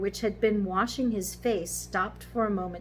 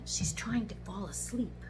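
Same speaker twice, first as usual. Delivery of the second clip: hushed whisper, softer; real whisper; 5 dB quieter than usual. Real, not acted. Grandparent explaining near a drowsy granddaughter, whispered. Granddaughter falling asleep nearby.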